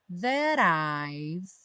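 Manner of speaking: "that I've" run together, T as a quick tapped R; linked T flap. Shown as a demonstration. Teacher showing learners the linking, "that I've" with tapped T. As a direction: slowly link "that I've" with a flapped T. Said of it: "aí ganhou o rarará no T".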